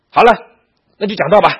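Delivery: loud, high, impatient command; imitating a cross old teacher; acted angry order. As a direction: order the student to get on with it. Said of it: "生这么大的气".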